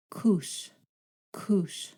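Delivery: "koos" opening on an airy k; regular K. Shown as a demonstration. Teacher models a regular K in a word, airy K demo. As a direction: say "koos" with a puff after the k. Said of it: "regular K sound, as in koos".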